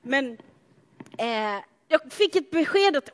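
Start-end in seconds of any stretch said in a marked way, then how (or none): none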